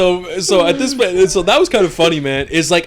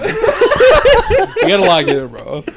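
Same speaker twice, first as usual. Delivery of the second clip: much higher, slow and laughing; 7 dB louder, much slower than usual. Real, not acted. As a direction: stretch it out while losing it to laughter. Laughing, stretched out.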